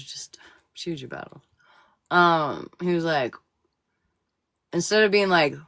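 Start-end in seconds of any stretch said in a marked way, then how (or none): 0.0-1.4 whisper. Retold or imitated. Wife quoting husband's calm advice in a whisper. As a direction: whisper it like a quiet aside.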